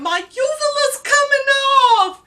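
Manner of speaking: high, loud re-enacted wail; panicked yelling. High patient tells her aunt about her uvula, panicked yelling. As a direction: shriek it like an alarmed, drugged patient. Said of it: "I was literally saying".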